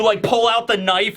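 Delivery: ordinary talk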